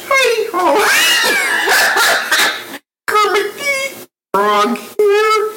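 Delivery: high, slow, stretched puppet frog voice; Kermit the Frog voice. Shown as a demonstration. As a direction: squeeze it up high and drag it out. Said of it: "Men or ladies do it like this".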